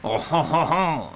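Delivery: hammy French "oh ho ho" chuckle; bad French accent. Player clowning after saying derrière, bad French accent. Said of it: "my bad French accent".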